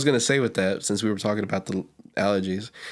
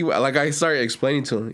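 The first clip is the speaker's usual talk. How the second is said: usual speaking voice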